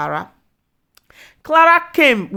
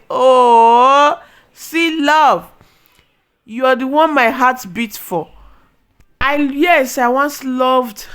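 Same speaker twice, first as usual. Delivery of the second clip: higher, louder, syrupy mock of the lovesick wife; mocking sweet lover; 5 semitones higher, 7 dB louder, slower than usual. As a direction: ham up her sweet talk, slowing at the end. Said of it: "I say".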